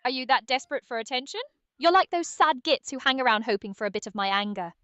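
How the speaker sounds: put-on contemptuous British chef accent; British Chef Ramsay roast. AI co-host roasting viewers for wanting a roast, scornful British accent. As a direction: tell them off in British, ending on an outburst. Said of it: "roast them for wanting a roast".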